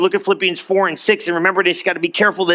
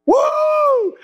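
high joyful whoop; excited whoop. Real, not acted. Preacher whooping to the congregation over good news, high and excited. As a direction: let out a celebration whoop.

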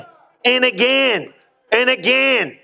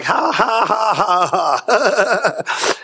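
acted-out laugh; much slower than usual. Preacher re-enacting laughing at trouble in his kitchen, staged laughter. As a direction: re-enact a big defiant ha-ha laugh. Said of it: "I started laughing right there in the kitchen".

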